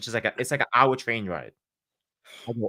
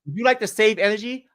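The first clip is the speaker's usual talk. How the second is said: higher, louder chipper door-to-door salesman voice; salesman pitch; 9 semitones higher, 8 dB louder than usual. Retold or imitated. Ex-soldier mimicking his old energy-sales pitch, higher and louder. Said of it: "come to your door and be like".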